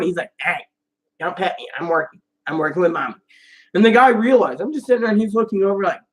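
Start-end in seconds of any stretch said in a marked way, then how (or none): 0.4-3.1 low, soft imagined dog voice; lightly voiced dog. Working service dog warning off petting, lightly voiced dog. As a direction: speak for the dog, lower and quieter. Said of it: "my dog's a servant ... He's like".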